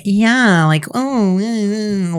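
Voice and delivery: mock Valley Girl whine; Valley Girl accent. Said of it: "the Valley Girl that just, like".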